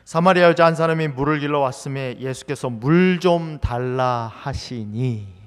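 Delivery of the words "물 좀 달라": slow request. Acted. Jesus asks the woman for water.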